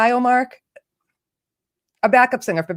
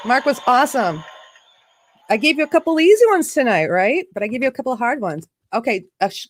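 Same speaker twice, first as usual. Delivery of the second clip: ordinary talk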